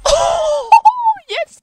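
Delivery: high squeal breaking into laughter; giddy cheer. Real, not acted. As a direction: squeal with delight. Celebrating kill.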